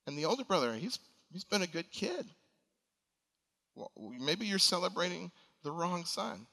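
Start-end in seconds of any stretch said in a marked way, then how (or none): none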